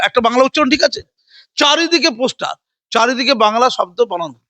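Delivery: usual speaking voice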